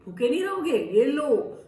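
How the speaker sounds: monkey generously feeding hungry guests; hearty offer. Acted. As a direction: boom out the generosity.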